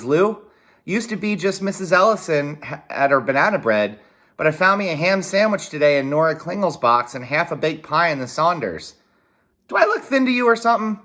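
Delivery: a countryman wondering aloud at the neighbours' charity; baffled father. Acted. Dad baffled by gifts of food.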